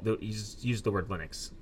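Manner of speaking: ordinary delivery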